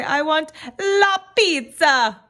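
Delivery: big, drawn-out Italian accent, high and loud; hammed-up Italian accent with stretched vowels. Shown as a demonstration. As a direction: belt it out like an exuberant Italian. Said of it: "in your best Italian accent".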